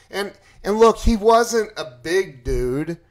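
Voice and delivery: ordinary talk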